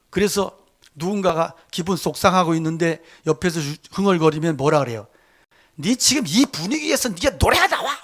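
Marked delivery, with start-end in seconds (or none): 5.8-8.0 higher-pitched mock scolding; acted scolding. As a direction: tear into the kids for singing at a bad moment. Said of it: "막 그러고 애들 겁나게 잡잖아요". (아이를 잡듯 높은 톤으로 꾸짖는 흉내)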